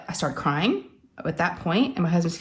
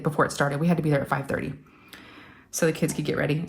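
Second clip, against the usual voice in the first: ordinary talk, nothing marked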